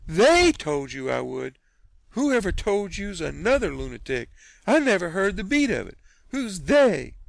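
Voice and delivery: high, loud and slowed outrage; aunt ready to box his ears; furious disbelief. Acted. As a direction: bristle at every word. Aunt Sally indignant.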